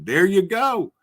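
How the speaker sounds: ordinary talk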